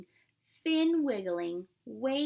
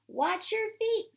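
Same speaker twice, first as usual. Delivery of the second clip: high, louder playful fish voice; fish's voice; 11 semitones higher, 5 dB louder than usual. Acted. Milton the fish warning the parade crowd, high and playful. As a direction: squeak it out like a tiny fish. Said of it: "said Milton".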